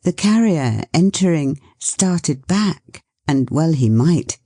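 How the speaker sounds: usual speaking voice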